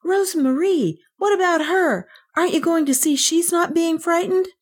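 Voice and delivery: put-on high, urgent child; anxious cry. A narrator's reading. Kay urges the policeman to check on Rosemarie, anxious. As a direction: cry out in high-pitched appeal. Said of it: "But Kay cried".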